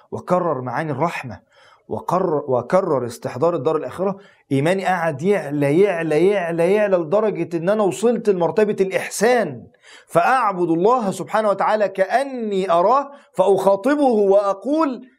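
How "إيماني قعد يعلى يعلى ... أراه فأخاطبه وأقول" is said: building preaching. Acted. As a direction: build the fervour step by step, rising. Preacher's faith rising, building.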